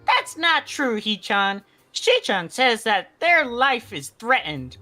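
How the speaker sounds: ordinary talk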